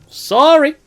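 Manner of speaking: loud, high, stretched sorry; mock apology. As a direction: sing out an exaggerated sorry. Player apologises to the orc.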